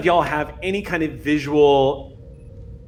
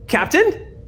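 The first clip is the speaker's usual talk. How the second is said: much higher, excited rising call; excited crewmate. Acted. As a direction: call out to the captain in high excitement. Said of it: "Exio's excited voice".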